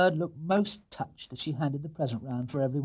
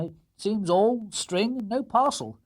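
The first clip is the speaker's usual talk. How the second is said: higher, slow dry jest; wry joke; 8 semitones higher, 3 dB louder, slower than usual. Acted. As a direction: joke about the string. Mr Brown jokes.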